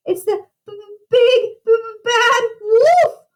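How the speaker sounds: high, stuttering pig in terror; chattering with fear. A narrator's reading. Frightened pig sees the wolf's eyes and claws, teeth-chattering stutter. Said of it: "Boris chattered".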